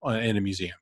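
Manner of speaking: normal talking voice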